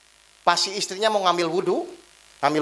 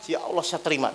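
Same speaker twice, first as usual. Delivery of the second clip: soft, low acceptance; restrained submission; 4 semitones lower, 6 dB quieter than usual. Retold or imitated. Preacher voices wife holding back retort, soft submission. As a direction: drop the voice and give in. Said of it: "tadinya pas mau ngelawan".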